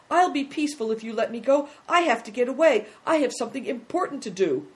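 pleading. Acted. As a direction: beg your captors to release you. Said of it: "now began to plead".

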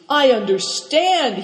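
high, loud mock lament; wailed. A narrator's reading. Good Fortune to Cao Chang, a put-on wail of regret. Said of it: "he wailed".

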